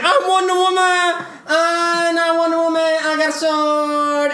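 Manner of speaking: high, droning parody heroine; Wonder Woman. Retold or imitated. Friend mocking Wonder Woman's entrance, high drawn-out voice. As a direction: announce it in a silly high monotone. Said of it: "And she's like".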